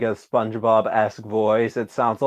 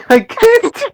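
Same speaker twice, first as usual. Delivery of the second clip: laughing; 6 dB louder than usual. Real, not acted. Laughing fit mid-sentence.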